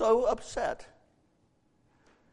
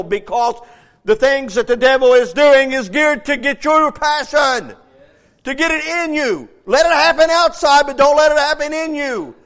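the Lord's rebuke; 5 semitones higher, 7 dB louder than usual. Retold or imitated. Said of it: "checked by the Lord to say".

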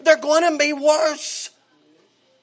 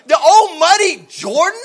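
loud, scornful protest at the muddy river; offended general refusing the muddy river; indignant Naaman; 5 semitones higher, 6 dB louder than usual. Acted. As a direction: scoff at the muddy Jordan, louder. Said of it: "This is what he's talking about".

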